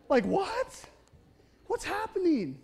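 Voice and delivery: can't believe what he just read; incredulous. Real, not acted. Preacher reacting to the talking donkey story, incredulous.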